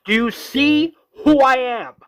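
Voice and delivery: high, loud acted demand; full-voiced retold scream. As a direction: yell it in a desperate confrontation. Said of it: "Rick is screaming".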